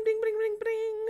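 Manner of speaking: high, loud sing-song ring-ring of a phone; playful imitation of a ringtone, loud and high. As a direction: chirp it out like a ringing phone.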